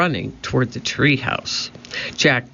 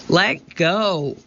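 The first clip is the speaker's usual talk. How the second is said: higher, defiant mild shout; 5 semitones higher than usual. A narrator's reading. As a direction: demand it with a contained yell. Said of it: "Annie shouted into his face".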